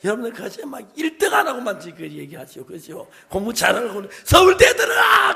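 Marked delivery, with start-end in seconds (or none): 3.3-5.3 mock parent shout. Mocking pushy parents' command.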